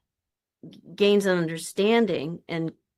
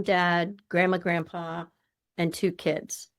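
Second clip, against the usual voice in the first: ordinary delivery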